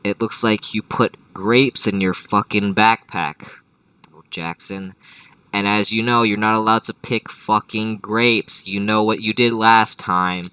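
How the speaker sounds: loud, accusing parental telling-off; exasperated parent voice, hammering the accusations; scolding parent. Acted. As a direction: lay into the kid, loud and stern. Acted parent scolding Jackson over grapes.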